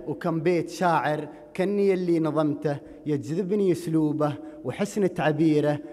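declaimed Gulf Arabic poem, measured and rhythmic; projected verse with drawn rhyme endings; poetry recitation. Acted. Recites his poem to audience.

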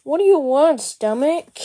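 loud call to the stomach; loud mock stomach voice; booming stomach voice. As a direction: boom it out like a rumbling belly. Character addresses own stomach.

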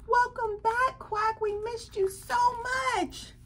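high-pitched, gushing crowd greeting; excited welcome. Acted. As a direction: welcome a long-missed friend home. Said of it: "Everybody's saying".

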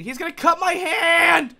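sudden high, loud cry of alarm; alarmed shout. Real, not acted. Player sees the knife coming for their hand, alarmed shout. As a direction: shout it as the danger dawns on you. Realizes game will hurt character.

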